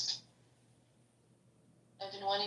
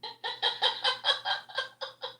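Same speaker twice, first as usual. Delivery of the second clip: high, breathy peal of laughter. Real, not acted. Friend reacting to the quip, high laughter.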